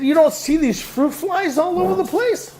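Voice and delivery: high, bewildered question; confused reply. Retold or imitated. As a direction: ask it baffled and lost. Retold own confused reply.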